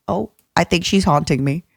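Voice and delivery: ordinary talk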